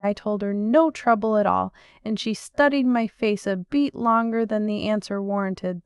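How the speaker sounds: ordinary delivery, nothing marked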